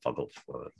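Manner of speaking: put-on low, hushed stab at Boston accent. Admitting he can't do it, soft Boston accent attempt. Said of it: "I can't do a Boston accent".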